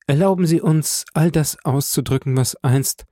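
hohe, bewegte Frauenstimme; inständig um Erlaubnis bitten; Julie, bittend. A narrator's reading. Julie will ihren Dank aussprechen. (leicht gespielte dankbare Julie)

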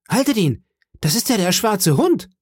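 high-pitched alarmed shout; urgent cry. Acted. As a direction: raise the alarm after a fleeing man. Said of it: "rief ich".

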